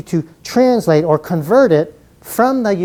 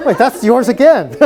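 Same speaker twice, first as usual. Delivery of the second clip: real laughter spilling into the words; amused protest through a laugh; 9 semitones higher, 3 dB louder than usual. Real, not acted. Teacher teasing a student for repeating an answer, laughing.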